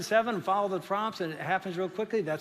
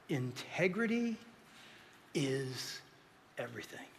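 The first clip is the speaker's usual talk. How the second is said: slow, softer, each word set apart; slow emphatic point; 5 semitones lower, 10 dB quieter than usual. Real, not acted. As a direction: pause between every word to land it. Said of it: "slow emphatic sermon point".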